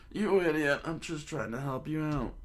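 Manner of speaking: high-pitched scolding retort; indignant old man. Acted. Old man retorts to laughing owner, indignant. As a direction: snap back in a high old-timer voice.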